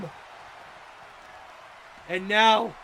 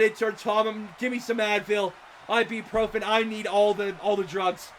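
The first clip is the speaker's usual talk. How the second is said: softer, worn-out voice; tired, strained plea; voice giving out; 5 semitones lower, 8 dB quieter than usual. Real, not acted. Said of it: "I'm not gonna have a voice".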